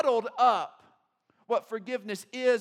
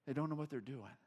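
quiet plea; 8 semitones lower, 10 dB quieter than usual. Retold or imitated. Jesus forgiving his killers, soft and low. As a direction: lower and softer, a gentle plea. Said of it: "he is just saying".

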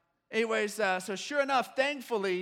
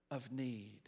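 slow reading, sinking low and soft; hushed scripture ending; 8 semitones lower, 10 dB quieter than usual. Acted. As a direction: let the verse settle slowly into a hush. Scripture reading slows to a hush.

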